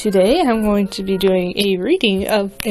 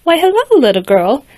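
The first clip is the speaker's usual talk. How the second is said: light, higher friendly greeting; a hint of a sweet princess; kindly princess; 6 semitones higher, 5 dB louder than usual. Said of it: "she said, looking down".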